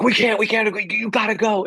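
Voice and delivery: high, stammering mock urgency; frantic urging. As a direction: act out a flustered producer pushing hard. Acting out producer urging him onstage.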